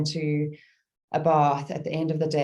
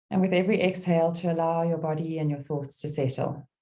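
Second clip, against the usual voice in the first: calm meditation guide with long pauses; gentle, spacious mindfulness voice; guided meditation. Real, not acted. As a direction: ease listeners into stillness, phrase by phrase.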